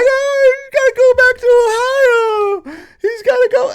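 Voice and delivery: exaggerated high-pitched mock sobbing lament; mock crying woman. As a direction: whine it like a weepy protester, hammed up.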